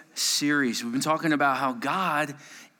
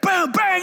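loud, much higher shouted bang bang; mock bang shout; 13 semitones higher, 5 dB louder than usual. Counselor blasting on the lights on the campers with a mock shout. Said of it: "I'm like".

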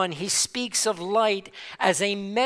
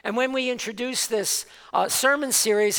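usual speaking voice

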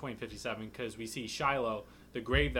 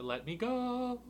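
high, drawn-out mock pleading; whiny captive plea; 12 semitones higher, slower than usual. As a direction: wail it like a captive begging for release. Acting out captive's demand.

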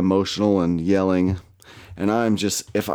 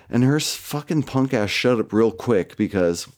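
ordinary talk, nothing marked

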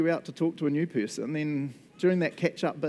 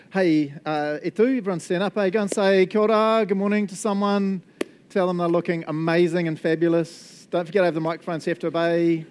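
higher, louder, upbeat call to the crowd; rallying call to the room; 4 semitones higher, 6 dB louder than usual. Real, not acted. Pastor calling the congregation to stand and greet, rallying and loud. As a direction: rouse the whole room to stand up.

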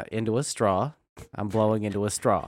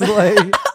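loud burst of laughter; laughing burst; 10 semitones higher, 13 dB louder than usual. Real, not acted. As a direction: laugh out hard at himself. Laughing at himself.

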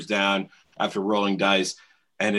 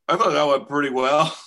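deluded cheer; 5 semitones higher, 6 dB louder than usual. Retold or imitated. Beaten narrator's deluded retold remark.

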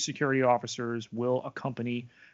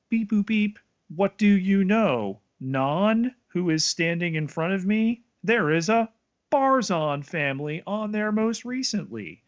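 excited; 7 semitones higher, 5 dB louder than usual. Pretend. Playful voicing of Non's scan.

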